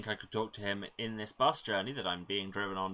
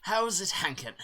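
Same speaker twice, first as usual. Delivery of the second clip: chirpy NPC greeting; 10 semitones higher, 6 dB louder than usual. Acted. Reading the NPC's greeting pun.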